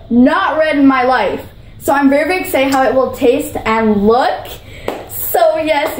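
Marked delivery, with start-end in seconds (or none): none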